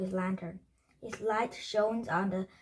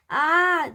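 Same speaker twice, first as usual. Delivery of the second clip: loud, high scream; 8 semitones higher, 9 dB louder than usual. A narrator's reading. All three flee the bones upstairs, screaming high and loud. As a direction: burst out in a high yell. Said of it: "they all screamed again".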